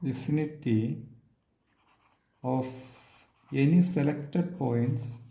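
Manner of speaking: very long pauses between short phrases; slow, piecemeal statement. Real, not acted. Lecturer stating a theorem to students, slowly and piece by piece. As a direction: dictate it slowly, a few words at a time.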